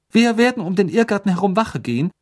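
crisp strict headmistress; McGonagall voice. A narrator's reading. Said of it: "sagte Professor McGonagall".

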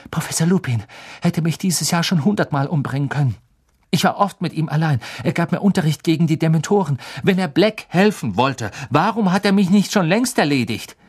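put-on indignant boy pleading his case; heated protest. A narrator's reading. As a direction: argue back urgently, rising in pitch. Harry arguing back at Snape.

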